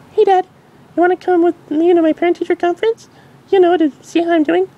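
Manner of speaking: very high filly voice; curious filly. Acted. Dinky asking her mom, curious filly. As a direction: ask with childlike curiosity.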